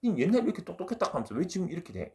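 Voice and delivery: high-pitched mock grilling; imitated indignant questioning; confrontational challenge. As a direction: demand an answer, as if acting out a quarrel. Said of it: "그냥 따지려고 보는 겁니다".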